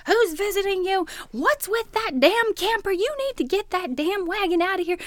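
high-pitched imitation of an angry woman's rant; mock screaming rant. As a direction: scold like the irate neighbor, hammed up. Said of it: "she's screaming at me about my camper".

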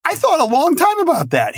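high whiny mock self-defence; hurt protest. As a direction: squeak it out like a wounded student. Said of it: "I'm like".

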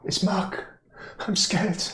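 small, high, scared voice; soft frightened whimper of a woman; Irina. Acted. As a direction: speak high and quiet, full of fear. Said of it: "it is the voice of Irina".

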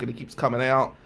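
ordinary talk, nothing marked

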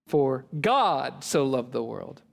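"God" stretched out, the whole verse slowed; slow down and weight the word "God"; stress on GOD. Shown as a demonstration. Professor emphasizing word after word, stress on "God". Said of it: "he just emphasized word after word".

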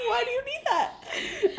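high, drawn-out laughing plea; mock desperate. Sam pleading with Dean for an explanation, desperate. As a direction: wail it in comic bafflement.

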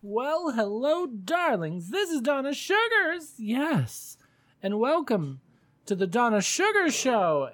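high, showy drag queen hostess; drag diva voice. Acted. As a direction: gush it like a glamorous diva host. Hosts as drag persona.

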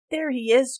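loud, high shout of discovery; cried out. Acted. Boy shouting to the men as he spots Tanchi, loud. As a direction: yell it out as he spots him.

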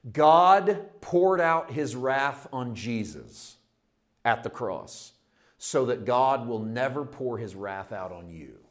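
put-on old preaching demo. Shown as a demonstration. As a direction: thunder it out from the pulpit. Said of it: "It sounded like this".

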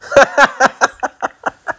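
quick high chuckle; a run of high, tapering laughter. Real, not acted. Podcaster cracking up at his own intro, high staccato laugh.

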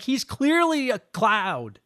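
slow and deliberate, spelling out the obvious. Retold or imitated. Ten-year-old self stating the obvious, slow and deliberate. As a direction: drag it out like it couldn't be plainer. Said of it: "and I was like".

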